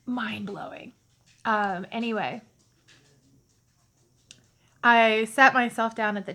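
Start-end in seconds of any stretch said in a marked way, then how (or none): 0.1-0.9 breathy whisper of awe; an awed whisper. Real, not acted. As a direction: hush it out in wonder. Whispered after a sigh.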